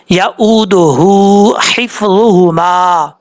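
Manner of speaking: slow chanted यऊदुहु हिफ्ज़ुहुमा with a heavy first ह and a thin second ह; slow Quranic recitation; tajweed demo. Shown as a demonstration. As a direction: chant it slowly with a heavy then light ह. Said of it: "इस तरीके से पढ़ना है".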